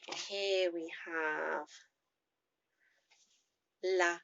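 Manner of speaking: normal talking voice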